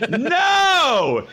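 high, drawn-out "no"; shouted refusal. Retold or imitated. Man refusing a groper in the alley, shouted refusal. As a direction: cry out a long, shocked no.

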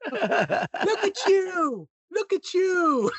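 high mock British yelp, slowing at the end; scared posh Brit. Imitating a scared YouTuber in a high British accent. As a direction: shriek it like a frightened posh Brit. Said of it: "He's so British".

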